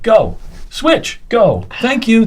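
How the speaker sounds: sharp, high calls over the music; calling out cues. Retold or imitated. Choreographer calls cues to the dancers, high and sharp. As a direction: bark the counts at dancers.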